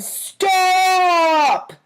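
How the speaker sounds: high, loud cry of "Stop!"; yell. A narrator's reading. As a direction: shout it to stop the dog. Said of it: "Amy yelled".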